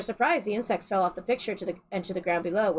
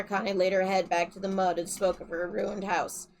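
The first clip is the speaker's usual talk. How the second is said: ordinary delivery, nothing marked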